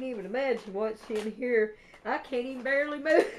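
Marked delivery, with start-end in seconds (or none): 2.0-3.4 quick words breaking into loud, high laughter; real giddy giggles; overwhelmed laughing. Real, not acted. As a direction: laugh helplessly as the sentence ends.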